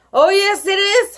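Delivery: high, loud holler. A narrator's reading. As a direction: holler it across the class. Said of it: "hollered Eric".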